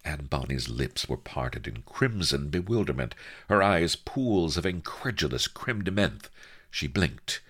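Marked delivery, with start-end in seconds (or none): none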